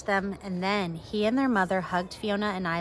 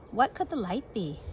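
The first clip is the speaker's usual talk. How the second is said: plain reading voice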